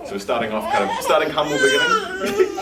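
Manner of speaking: breaking into high laughter mid-phrase; words squeezed out through giggles; laughing. Real, not acted. Host presenting the program to families, laughing through it.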